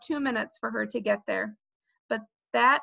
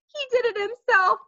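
high wail, louder on the repeat; crying louder; 14 semitones higher, 6 dB louder than usual. A narrator's reading. Sheila blames Fudge for his fall, crying louder. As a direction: shift the blame in a rising wail. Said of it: "Sheila cried louder".

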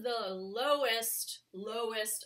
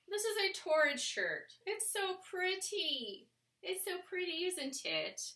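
high-pitched put-on British accent; hammed-up British accent, pitched well up; 10 semitones higher than usual. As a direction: gush in a playful mock British accent, drawing words out. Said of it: "speak to you with my British accent".

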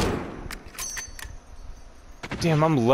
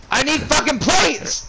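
frustrated shout; 10 semitones higher than usual. Real, not acted. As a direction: yell it out of pure gaming frustration. Teammates took all the plates.

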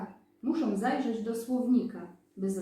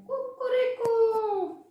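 high, loud, slow rooster crow; angry rooster crow; 5 dB louder, slower than usual. A narrator's reading. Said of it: "kogut na patyku zapiał gniewnie". (gniewne pianie koguta)

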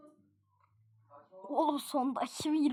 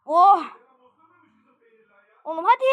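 normal talking voice

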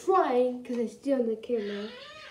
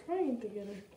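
ordinary talk

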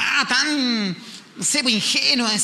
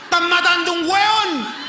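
high, slow, drawn-out cry of alarm; yelling across a party that someone is being killed; mock alarm; 10 semitones higher, 3 dB louder, slower than usual. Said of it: "shouted joke line at party".

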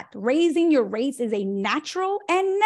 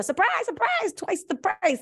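high, slow sing-song mock reveal; mock surprise; 9 semitones higher than usual. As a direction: tease the sudden price jump. Mocking sudden price hike.

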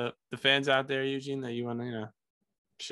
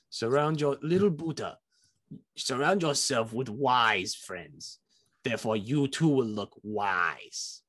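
slow, lightly put-on wise sage; hint of a sage accent, slow; mock Confucius voice; slower than usual. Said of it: "I was trying to be Confucius".

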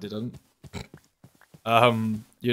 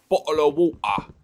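exaggerated posh British meme voice, higher and far from his own; meme British accent; 5 semitones higher, 4 dB louder than usual. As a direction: ham up the classic bottle of water British meme. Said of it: "laughing at memes where people say".

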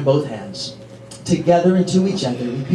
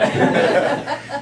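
laugh; 10 semitones higher than usual. Real, not acted.

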